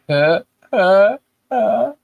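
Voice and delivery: breathy huh-huh-huh chuckle copied from mom; a light imitation of mom's stop-start laugh; imitating mom's laugh. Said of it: "she does what Kendra just did".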